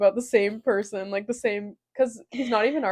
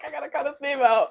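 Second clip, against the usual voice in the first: ordinary delivery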